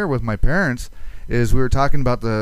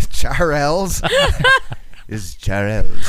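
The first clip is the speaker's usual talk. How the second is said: French pronunciation; 2 semitones higher, 3 dB louder, much slower than usual. Pretend. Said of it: "That's French".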